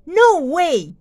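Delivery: high, loud flat-out no; firm refusal. Acted. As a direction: shut it down hard. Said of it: "said Farmer Brown".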